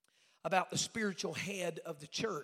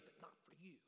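retold in a near whisper; hushed warning; 20 dB quieter than usual. Bishop warning preacher off the platform, hushed. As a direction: drop to a breath to shut him out.

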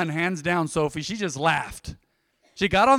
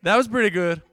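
ordinary delivery

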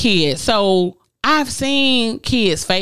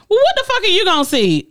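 incredulous rant; 9 semitones higher, faster than usual. Real, not acted. Ranting about date's excuse.

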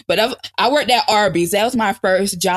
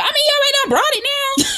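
sassy high retort; faster than usual. Retold or imitated.

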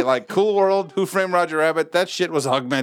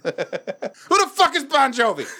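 slow, very high outraged question; mock yelling; 11 semitones higher, slower than usual. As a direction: yell it drawn-out in pretend fury. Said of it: "speaking of yelling".

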